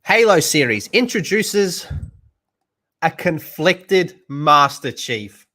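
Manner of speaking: headline read. Shown as a demonstration. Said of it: "let me read that again out loud".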